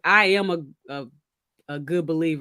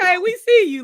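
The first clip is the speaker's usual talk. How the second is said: higher, louder, playful approval; knowing tease; 11 semitones higher, 6 dB louder than usual. Shown as a demonstration. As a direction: say it with an impressed wink. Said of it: "We mean, like".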